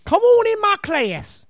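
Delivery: high, slow, hammed-up Baltimore teacher; Baltimore accent impression. Showing up the host's attempt at the teacher, exaggerated Baltimore accent. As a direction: draw out a thick Baltimore O. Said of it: "I should have let Tony do it".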